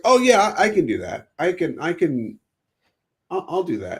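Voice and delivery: high, excited retold 'oh yeah'; acted-out eagerness, pitched well up; eager agreement. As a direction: leap in like a keen recruit. Acting out his eager younger self.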